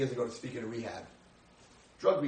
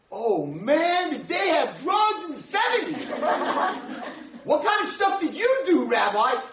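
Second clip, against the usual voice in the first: much higher, loud eager shout; excited shout; 14 semitones higher, 6 dB louder than usual. Retold or imitated. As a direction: fire off the question, thrilled. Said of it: "this guy's all excited".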